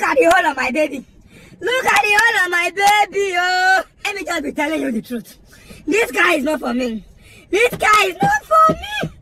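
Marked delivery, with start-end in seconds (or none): 1.6-3.8 mock wailing. Car owner mourning the hole on the car, wailing. Repeated as wail over damaged car. 7.5-9.1 very high, wailing protest that climbs at the end; high-pitched outcry. Acted. Car owner despairing over this guy, shrieking high. Repeated as outraged cry.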